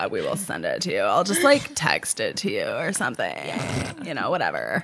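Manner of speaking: put-on bored valley-girl creak, pitched low; vocal fry. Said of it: "how good [name] was at vocal fry".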